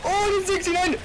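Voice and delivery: high, loud delighted shout; excited shout. Real, not acted. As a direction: burst out in high glee. Surprise at game result.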